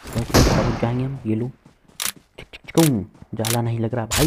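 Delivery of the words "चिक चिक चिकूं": imitated gun clicks.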